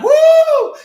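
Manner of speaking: high whooping cheer; mock crowd whoop. Congregation at the orchestra hit, mock whoop. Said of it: "and it's like".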